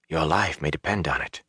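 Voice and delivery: hushed, understated remembered voice; softly, like a warning echoing in the mind; Moraine. A narrator's reading. Woman's warning recalled in his thoughts, hushed. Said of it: "as if he had heard Moraine say it".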